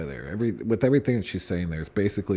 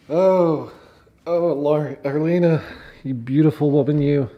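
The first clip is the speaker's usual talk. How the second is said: louder, higher fond exclamation; mock adoration; 6 semitones higher, 7 dB louder than usual. Real, not acted. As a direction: gush over her mockingly. Mock admiration of the author.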